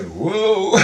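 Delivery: high-pitched mock whoa; startled. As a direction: act out dodging a blade, pitch swooping up. Acts out dodging a sword.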